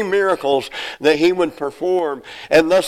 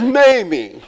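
laughing maybe; 7 semitones higher than usual. Retold or imitated. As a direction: crack up while quoting her. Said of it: "She says".

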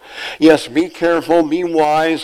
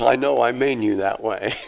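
God chuckling. Acted. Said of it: "God says".

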